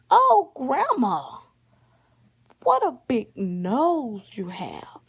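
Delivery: loud, slow, drawn-out storybook marvel; hammed-up child's amazement. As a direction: stretch each exclamation in big swooping wonder.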